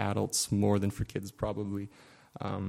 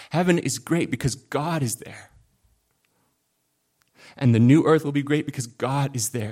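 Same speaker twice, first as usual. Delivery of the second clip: louder, higher, driving each not; full-force preaching; 3 semitones higher, 5 dB louder than usual. Acted. Pastor building to a climax for the congregation, impassioned. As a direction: preach it with rising fervour.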